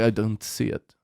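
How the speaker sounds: soft, low mock Russian accent; accented clerk. Clerk to customer about a missing order, glum Russian accent. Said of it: "he had an accent too".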